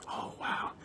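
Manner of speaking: breathless whispered awe, imitating people; a hushed, impressed gasp of a quote; mock awe. Mocking people who gush at heroes, hushed awe. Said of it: "we want people to go".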